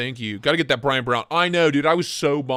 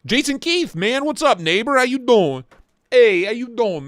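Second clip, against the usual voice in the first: much higher and louder, hammed-up buddy greeting; goofy high greeting voice; 8 semitones higher, 5 dB louder than usual. Greeting a viewer in a silly put-on voice. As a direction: ham up an over-eager hello to a neighbor. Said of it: "We don't talk like that here".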